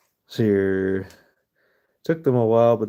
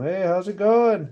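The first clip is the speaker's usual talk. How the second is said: higher, louder casual call out a window; joke yell; 10 semitones higher, 5 dB louder than usual. Retold or imitated. Man at window calling to the falling jumper, cheerful yell. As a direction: call out cheerily across the gap. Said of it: "yells out the window to him".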